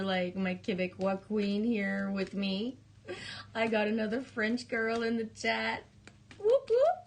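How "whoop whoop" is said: excited whoop. Real, not acted. Cheering new French viewer.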